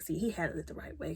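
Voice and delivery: hushed whisper to the viewer; soft whispered approval; whispered aside. Real, not acted. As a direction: whisper it like a secret comment.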